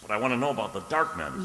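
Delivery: insistent demand. Acted.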